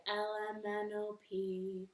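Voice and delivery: ordinary delivery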